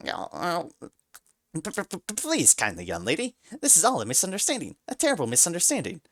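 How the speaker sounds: stuttering mouse, rushing; panicked stammer. A narrator's reading. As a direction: babble for your life. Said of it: "And panicking".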